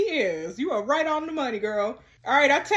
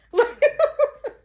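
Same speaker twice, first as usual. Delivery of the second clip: loud laughter; 13 semitones higher, 6 dB louder than usual. Real, not acted. Laughing at the choice.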